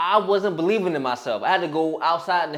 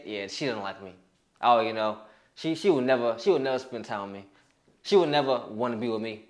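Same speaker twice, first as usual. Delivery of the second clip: low, mildly dejected inner voice; acted touch of resignation; defeated self-talk; 6 semitones lower, 3 dB quieter than usual. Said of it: "you're talking to yourself like this".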